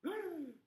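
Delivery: sharp, high shocked gasp; a quick startled intake of breath. Acted. Shocked reply about the court.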